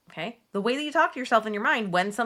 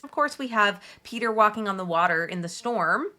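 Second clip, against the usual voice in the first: usual speaking voice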